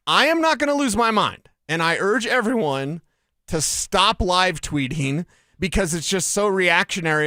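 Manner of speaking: normal talking voice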